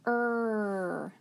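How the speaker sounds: long held "er"; drawn-out "er" phonics sound; isolated R sound. Shown as a demonstration. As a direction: stretch "er" out on one breath. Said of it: "we're gonna try our spelling sounds".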